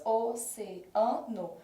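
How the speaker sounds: "oceano" very slowly, syllable by syllable: o-ce-a-no; teacher sounding out "oceano" as o-ce-a-no for the class; split into syllables. Shown as a demonstration. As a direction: draw out "oceano" into slow separate syllables. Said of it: "Quando eu separo em sílabas".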